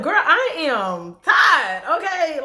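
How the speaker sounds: high, slow and drawn-out, loud; venting to a work friend at day's end, drawn out and high; relaxed girlfriend talk. Retold or imitated. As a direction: unwind with your girl, stretched out. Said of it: "talk like we talk to each other".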